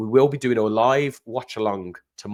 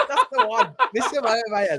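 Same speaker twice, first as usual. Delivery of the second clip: laughing; 11 semitones higher than usual. Real, not acted. Laughing at the name.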